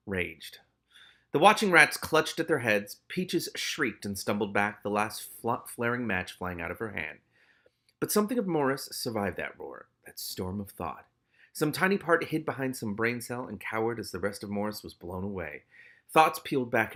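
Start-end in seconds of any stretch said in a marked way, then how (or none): none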